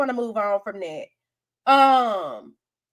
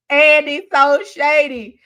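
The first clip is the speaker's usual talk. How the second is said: ordinary talk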